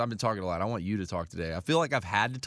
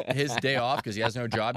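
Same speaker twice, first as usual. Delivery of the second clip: ordinary delivery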